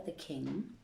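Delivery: usual speaking voice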